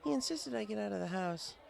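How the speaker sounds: put-on high, bookish young mare voice, caught off guard; Twilight Sparkle. Twilight puzzled the bartender knows her name, high voice. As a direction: pitch it up as a studious unicorn, puzzled.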